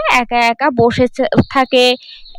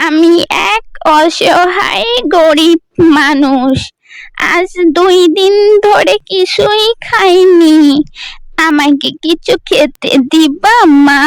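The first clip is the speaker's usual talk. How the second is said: put-on slow, pleading old woman's call at the door; old beggar woman; 5 semitones higher, slower than usual. Old beggar woman calling at the girl's door, slow and pleading. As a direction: beg slowly like a hungry old woman.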